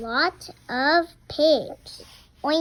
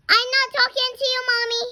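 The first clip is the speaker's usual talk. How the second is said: high, fast and louder, cross; snappy; 9 semitones higher, 4 dB louder, much faster than usual. Real, not acted. Child telling Mommy she is not talking to her, high and fast. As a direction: fire it back at Mommy quickly.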